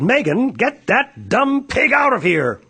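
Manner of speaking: slow, scolding teacher; stern order. A narrator's reading. Teacher scolding Megan about the pig, stern loud order. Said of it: "The teacher said".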